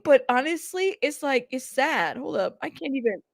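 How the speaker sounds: ordinary talk, nothing marked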